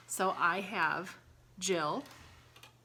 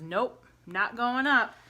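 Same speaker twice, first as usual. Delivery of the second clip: stubborn dog; 3 semitones higher, 7 dB louder, slower than usual. Acted. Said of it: "He says".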